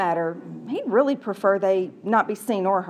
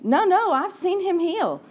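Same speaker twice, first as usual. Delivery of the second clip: eager insistence; 9 semitones higher than usual. Acted. Nahare insisting to mistress.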